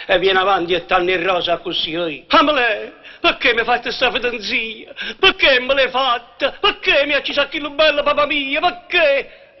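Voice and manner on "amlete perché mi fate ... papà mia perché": high, slow, wailing lament; tragic mad scene. Shown as a demonstration. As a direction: ham up the grieving madwoman. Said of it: "Va, attacca, su".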